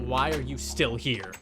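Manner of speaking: ordinary delivery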